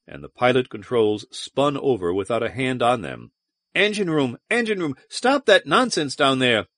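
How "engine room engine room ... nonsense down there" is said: high-pitched command down the intercom; raised, alarmed order to the crew; urgent calling out. A narrator's reading. Officer calls the engine room, urgent calling out.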